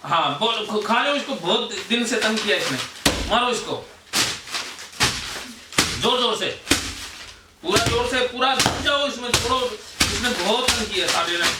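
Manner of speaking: fired-up exhortation. Acted. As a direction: whip the group into venting their rage. Catharsis guide urging anger release. (जोश भरा, ऊँचा, उकसाता हुआ आह्वान)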